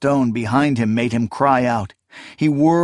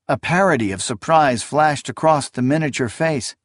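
ordinary delivery

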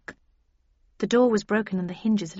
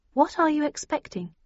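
higher-pitched, mildly uneasy question; slightly nervous; 7 semitones higher than usual. A narrator's reading. Heroine asking what danger awaits, slightly nervous. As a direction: ask with a touch of nerves. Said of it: "I asked slightly nervously".

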